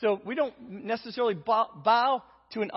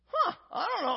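mock innocent huh; 8 semitones higher than usual. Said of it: "And I'm like".

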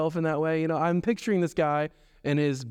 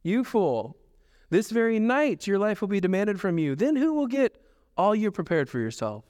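God's rebuke; 5 semitones higher than usual. A narrator's reading. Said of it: "The Lord says this".

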